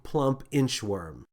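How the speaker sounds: ordinary delivery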